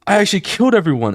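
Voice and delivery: breaking down. Retold or imitated. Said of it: "she starts breaking down".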